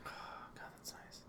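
breathy whispered delight; whispering. Real, not acted. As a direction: whisper it in bliss. Whispered pleasure.

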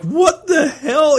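high, loud, slow What the hell through laughter; cracking up in stunned disbelief; laughing disbelief. Retold or imitated. Host recalling hearing the actor's real accent, laughing disbelief.

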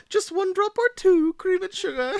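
high, loud put-on hostess voice; playful character voice. Teasing a friend over the frosting-like mask, high character voice. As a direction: offer it in a silly falsetto.

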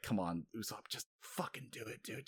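hushed, whispered urging; imitated impatient stage whisper; urgent aside. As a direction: urge a friend on under your breath. Voices crew urging under their breath.

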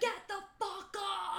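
high, slow acted yell; mock British scream. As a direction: yell it like an irate Brit, hammed up. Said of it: "He's kinda screaming at him".

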